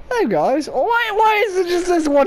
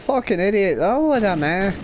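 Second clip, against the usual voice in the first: ordinary delivery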